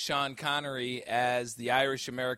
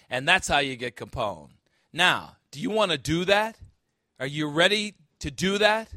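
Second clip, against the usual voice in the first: louder, higher, rousing preacher; full-force preaching; 3 semitones higher, 7 dB louder than usual. Acted. Pastor challenging his congregation, rousing full-force preaching. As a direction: fire up the congregation.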